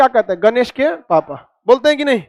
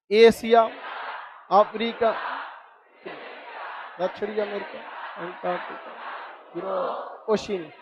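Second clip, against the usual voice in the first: softer, unhurried recital with long gaps; slow and unhurried; 4 semitones lower, 11 dB quieter than usual. Shown as a demonstration. Teacher shows students how to recite calmly, slow and soft. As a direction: take your time over each continent, no rush. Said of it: "पहला बोलिए तो आराम आराम से, जल्दबाजी नहीं, एकदम इत्मीनान से".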